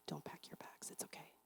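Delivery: whispered soothing from mom. Retold or imitated. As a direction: hush her gently, voiceless. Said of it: "My mom's like".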